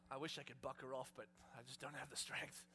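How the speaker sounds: low, soft, worn-out voice; decrepit old horse. Acted. Decrepit horse too weak to buck her off, low and feeble. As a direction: sigh it out like a feeble old animal. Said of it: "the horse is gonna go".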